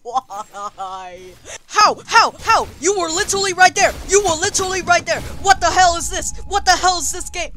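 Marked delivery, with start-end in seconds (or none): 1.7-7.5 fast, high, loud rant; outraged yelling. Real, not acted. Player protesting a death to the game, outraged yelling. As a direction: yell it in rapid-fire disbelief.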